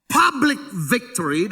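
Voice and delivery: "Public victory" drawn out, each word weighted; stately slow stress on "Public"; slow emphatic quote. Real, not acted. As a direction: lay the maxim down slowly. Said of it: "dalam bahasa Inggrisnya".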